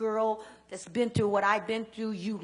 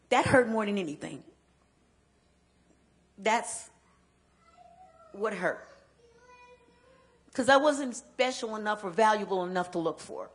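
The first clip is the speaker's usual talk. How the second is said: pained emphasis; 7 dB louder than usual. Real, not acted. Pain of neglect.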